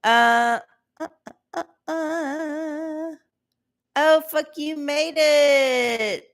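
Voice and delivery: slow high silly voice; mock baby talk. Greeting a chat viewer in a silly high voice. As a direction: coo at them like a pet.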